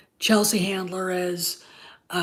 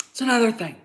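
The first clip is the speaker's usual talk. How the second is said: ordinary talk, nothing marked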